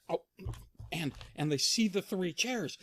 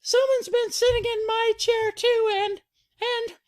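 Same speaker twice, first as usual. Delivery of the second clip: high falsetto breaking into a loud, slow wail; hammed-up tearful cub; Baby Bear distraught; 9 dB louder, slower than usual. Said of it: "Baby Bear says".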